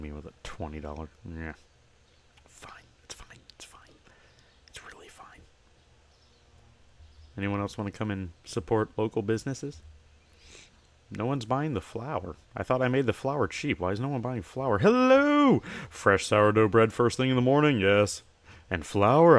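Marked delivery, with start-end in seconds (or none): 2.4-5.4 barely audible muttered grumble; whispered sulk. Real, not acted. Shopkeeper grumbling about a customer's twenty, in a whisper. 14.8-19.4 cheery shopkeeper. Acted. Shopkeeper hailing a customer entering the store, cheery and loud.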